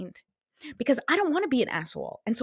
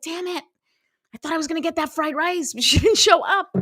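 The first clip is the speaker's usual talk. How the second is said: mock frustrated whine; 6 semitones higher than usual. Disappointed friend cancelled.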